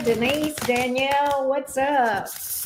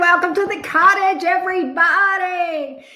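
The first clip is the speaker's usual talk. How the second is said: loud greeting; 4 semitones higher, 9 dB louder than usual. Real, not acted. Host greets live stream viewers.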